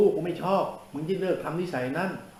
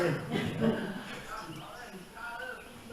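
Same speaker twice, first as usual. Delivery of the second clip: laughing; 7 semitones higher, 8 dB quieter than usual. Real, not acted. Laughs mid scolding.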